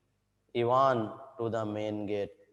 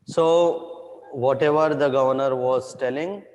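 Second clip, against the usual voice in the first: loud; 2 semitones higher, 8 dB louder than usual. Real, not acted. Teacher calling out loudly.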